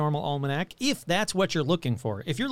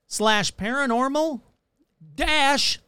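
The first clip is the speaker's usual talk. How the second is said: "slash paranormal dash" in separated, higher-pitched chunks; slowed down; 6 semitones higher, slower than usual. Shown as a demonstration. Host spelling out the merch address, slowed down. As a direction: drag out "dash" after a clear pause. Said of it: "Let me slow that down".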